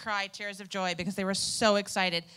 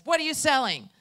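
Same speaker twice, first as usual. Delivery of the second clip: retold yell; 6 semitones higher, 8 dB louder than usual. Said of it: "he yells across the street".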